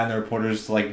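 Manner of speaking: normal talking voice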